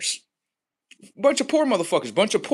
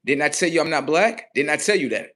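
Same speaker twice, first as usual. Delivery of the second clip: ordinary talk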